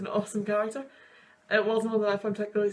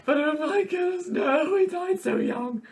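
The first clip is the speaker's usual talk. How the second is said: upset; 8 semitones higher, 3 dB louder, slower than usual. Real, not acted. Upset after a sad clip.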